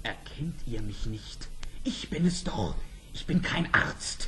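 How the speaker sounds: leises Flüstern; flüsternd. Acted. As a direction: heimlich zuflüstern, damit keiner lauscht. Heimliches Erkennen, Lauscher fürchten. (der Prinz flüstert der Prinzessin zu)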